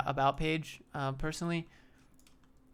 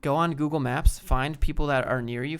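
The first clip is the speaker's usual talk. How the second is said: normal talking voice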